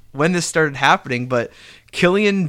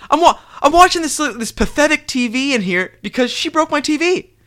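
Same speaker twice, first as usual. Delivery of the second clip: high-pitched whiny complaint, retold; mock indignant; 10 semitones higher than usual. Wrestler complaining in the spare room, mock indignant. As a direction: imitate an aggrieved rant in a raised pitch.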